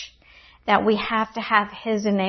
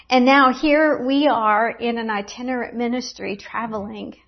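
ordinary talk

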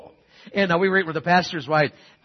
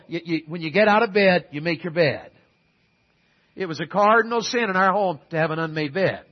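ordinary talk

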